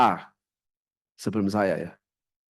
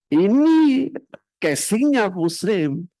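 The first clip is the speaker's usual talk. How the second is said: much higher and louder, then slow; sudden outburst; 8 semitones higher, 3 dB louder, much slower than usual. Real, not acted. Preacher denouncing a hypocrite colleague, sudden loud outburst. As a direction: burst out in condemnation.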